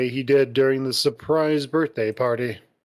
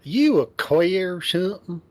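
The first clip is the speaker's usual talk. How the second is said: put-on southern accent; 5 semitones higher than usual. Teasing Carl about his love life, southern accent. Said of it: "You can say it with a southern accent".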